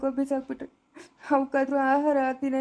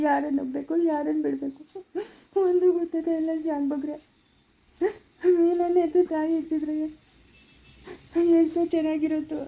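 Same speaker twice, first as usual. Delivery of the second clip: higher-pitched, weepy pleading; tearful lament; 4 semitones higher than usual. Acted. Betrayed man pours out his grief to the elder, tearful. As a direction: lament through tears, voice raised in pitch.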